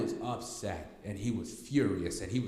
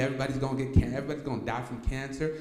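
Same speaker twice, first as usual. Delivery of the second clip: ordinary talk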